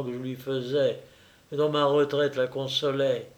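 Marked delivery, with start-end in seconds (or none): none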